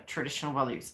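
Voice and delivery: usual speaking voice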